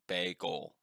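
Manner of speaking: "Bagel" said as bay-goal, stretched vowels; "Bagel" spelled out as bay goal; Google bagel. Shown as a demonstration. Explaining how Google says it, "Bagel" as bay-goal. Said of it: "looked up on Google how to say it".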